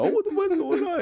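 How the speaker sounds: very high panicked squeal, retold; hammed-up high-pitched panic; mock scared. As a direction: act out sudden alarm in falsetto. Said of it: "I'm all scared and shit".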